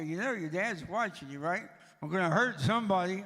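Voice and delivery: put-on wife yelling at boys fighting over a toy, loud scolding; mom scolding. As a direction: bark it at brawling boys as a fed-up mom. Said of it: "Catherine would walk in and go".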